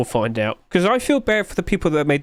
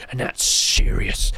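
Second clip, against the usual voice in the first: whisper; 7 semitones lower, slower than usual. Pretend. Mock-grave comment on low sales.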